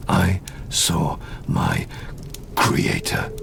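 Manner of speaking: slow hushed whisper. Acted. Creature recalling his creator, slow whisper. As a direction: breathe the words as a secret memory.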